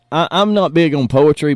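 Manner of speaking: ordinary delivery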